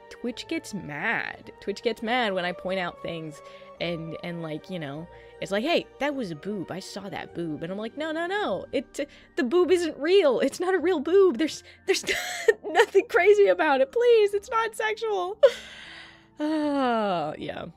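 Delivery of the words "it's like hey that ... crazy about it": normal talking voice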